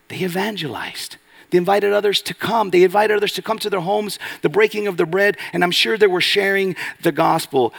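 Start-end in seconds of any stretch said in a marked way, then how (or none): 1.5-7.7 raised, urgent preaching voice. Real, not acted. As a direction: push the call to invite others. Preacher urging evangelism.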